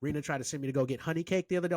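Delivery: ordinary talk, nothing marked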